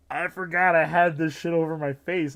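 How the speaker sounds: high, very loud exclamation; loud outburst. Retold or imitated. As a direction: blurt it out at full volume. Recalls his shocked outburst when seen.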